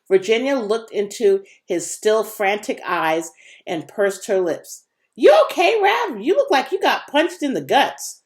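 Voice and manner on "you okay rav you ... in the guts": put-on high, concerned young girl voice; worried girl. Virginia checking on her queasy friend, high and worried. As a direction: ask gently, pitched well up.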